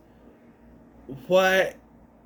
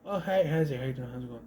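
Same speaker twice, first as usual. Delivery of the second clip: offhand mock greeting; 3 semitones lower, 8 dB quieter, faster than usual. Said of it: "it's basically".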